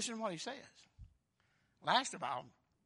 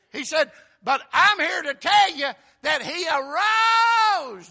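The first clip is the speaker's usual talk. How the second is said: high shouted proclamation, long held climax; full-force preaching; 10 semitones higher, 17 dB louder than usual. Real, not acted. Preacher proclaiming the angel's message to congregation, full-force preaching. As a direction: shout it out, stretching the final word.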